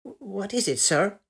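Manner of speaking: hesitant, deferential son; dutiful son awaiting orders; respectful. A narrator's reading. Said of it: "and respectfully".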